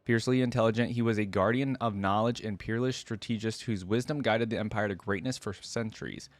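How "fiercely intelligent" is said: ordinary talk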